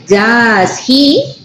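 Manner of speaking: drawn-out "Does he" rising on "he"; rising intonation. Shown as a demonstration. John doesn't speak Spanish, asking unsure, rising intonation. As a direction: really ask it, lifting "he" at the end. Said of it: "the intonation goes up in that case".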